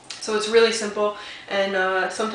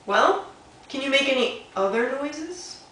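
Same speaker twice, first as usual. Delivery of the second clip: higher, curious owl; 6 semitones higher, slower than usual. Acted. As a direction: ask it like a nosy owl. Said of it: "said Little Owl".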